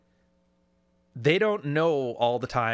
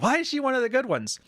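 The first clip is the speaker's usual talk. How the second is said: mock viewer question; 10 semitones higher than usual. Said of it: "And you're like".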